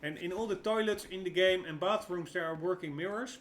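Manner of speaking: ordinary talk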